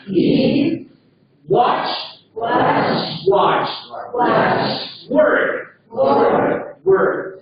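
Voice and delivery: each word said clearly for students to repeat; drill repetition. Shown as a demonstration. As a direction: model each word slowly for the class. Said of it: "So, repeat with me".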